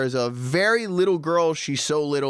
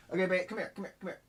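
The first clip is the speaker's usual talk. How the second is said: soft coaxing; 6 semitones higher, 10 dB quieter, much faster than usual. Real, not acted. Calling the baby over.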